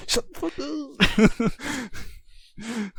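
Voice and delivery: high-pitched imitation of a movie outburst; mock outburst. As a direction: squeeze it out high without real volume, imitating the actor. Imitating Lillard's line in the scene.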